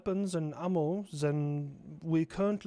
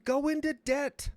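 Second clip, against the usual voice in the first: mock scolding; 9 semitones higher, 12 dB louder than usual. Scolding Ukraine in character.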